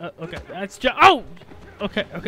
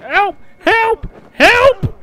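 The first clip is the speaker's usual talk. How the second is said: cry for help; 3 semitones higher, slower than usual. Real, not acted. Player in trouble calling teammates, rising cry for help. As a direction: call out more urgently each time.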